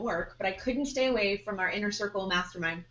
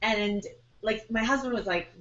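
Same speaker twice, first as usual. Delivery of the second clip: normal talking voice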